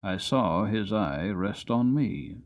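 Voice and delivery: usual speaking voice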